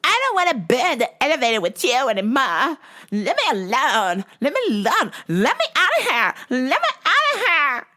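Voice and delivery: put-on a girlfriend losing it, climbing in pitch; comic imitation of a panicking woman; hysterical girlfriend.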